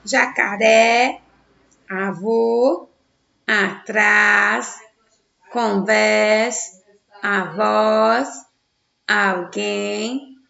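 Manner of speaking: each word slow, last syllable stretched, as in "jacaré" and "parabéns"; over-articulate for repeating, leaning on the endings of "avô", "atrás", "alguém"; stressed final syllables. Shown as a demonstration. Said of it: "pronunciar a sílaba tônica". (última sílaba tônica bem marcada, como em "jacaré" e "parabéns")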